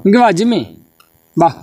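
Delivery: high coaxing call. Real, not acted. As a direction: sweetly coax the dog. Coaxing dog Jimmy.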